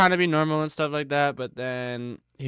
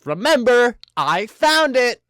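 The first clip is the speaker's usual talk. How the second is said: much higher and louder, slow nerdy cry; nerdy boy quote; 11 semitones higher, 8 dB louder than usual. Retold or imitated. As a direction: squeal it out at the camera like the nerdy kid. Nerdy boy breaks fourth wall.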